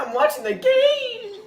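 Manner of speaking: squeaky high cracking mock; imitating voice crack. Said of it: "his voice cracked so hard".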